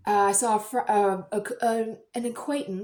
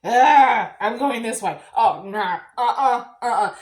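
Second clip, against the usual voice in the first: alarmed refusal; 9 dB louder than usual. Retold or imitated. Acts out men backing away from snakes.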